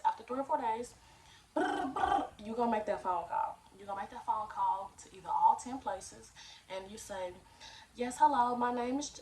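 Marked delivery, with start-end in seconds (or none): 1.5-2.2 high, loud trilled ringtone noise; phone ringing. Shown as a demonstration. Demonstrating the follow-up call, imitated phone ring. 7.9-9.2 higher, louder polite phone voice; customer service voice. Shown as a demonstration. Teen applicant phoning a workplace, customer service voice. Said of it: "put your customer service voice on".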